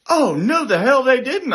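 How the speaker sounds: high, loud outraged reaction; indignant disbelief. Retold or imitated. Supervisor hearing airman was abandoned, indignant.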